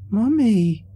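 put-on a small girl refusing to let go; insistent little girl. A narrator's reading. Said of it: "she insisted".

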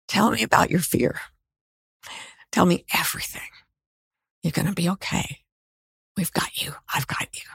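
softening to a hushed whisper at the end; soothing reassurance. Retold or imitated.

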